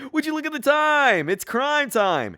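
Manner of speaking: high, slow, sing-song villain line; hammy villain; villain catchphrase. Acted. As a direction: savor the catchphrase like a cartoon baddie. Villain announces crime time.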